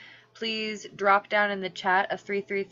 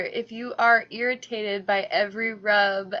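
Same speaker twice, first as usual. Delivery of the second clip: ordinary delivery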